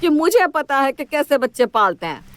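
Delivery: high, sharp outburst that settles; indignant complaint. Acted. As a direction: burst out high, then complain. Mother-in-law complains to son.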